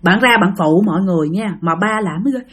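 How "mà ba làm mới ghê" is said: ordinary delivery, nothing marked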